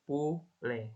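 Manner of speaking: "poulet" as poo-LAY with a rounded French u and silent t. Shown as a demonstration. Teacher models French "poulet" for students, rounded u and silent t. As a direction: lips pointed for the u in "poulet". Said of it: "Terus ada poulet".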